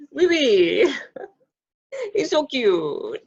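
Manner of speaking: playful, drawn-out French accent. Shown as a demonstration. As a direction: put on a loud mock French accent. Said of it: "French accent".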